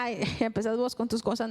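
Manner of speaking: a mother laughing off her daughter's nagging, lightly voiced; mother brushing her off. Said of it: "Y yo decía".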